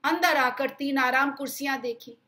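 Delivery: usual speaking voice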